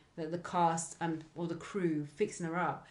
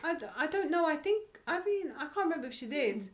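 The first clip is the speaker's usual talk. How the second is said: much higher, laughing, stammering; high flustered groom; 8 semitones higher than usual. Retold or imitated. Groom to producers, high and flustered with a laugh. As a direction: fumble it nervously up high. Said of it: "He was like".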